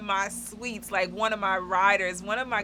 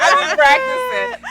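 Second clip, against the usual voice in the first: laughing high; 13 dB louder than usual. Real, not acted. Joking about practiced voice.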